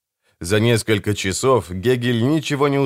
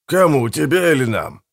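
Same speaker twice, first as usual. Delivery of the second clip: higher, teasing question; smirking; 5 semitones higher than usual. A narrator's reading. Gegel taunts the dying Bertram, smirking. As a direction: ask it with an audible smirk. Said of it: "ухмыльнулся Гегель".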